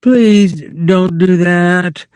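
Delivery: high, slow pleading; Lenny begging her not to scream. A narrator's reading. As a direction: plead with her, scared of George. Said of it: "he begged".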